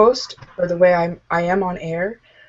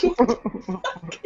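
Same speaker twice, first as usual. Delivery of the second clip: slow, softer reaction of disbelief; drawn-out strangled mock shock; stifled laughter; 3 semitones higher, 5 dB quieter, much slower than usual. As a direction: re-enact choking back a laugh. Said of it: "I tried so hard to remain professional and not laugh".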